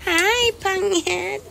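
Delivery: high, slow sing-song cooing; excited. Real, not acted. Cooing as the car arrives.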